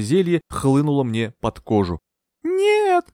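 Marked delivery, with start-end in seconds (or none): 2.4-3.1 much higher desperate scream. A narrator's reading. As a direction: scream as the needle goes in. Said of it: "закричала я". (отчаянный крик)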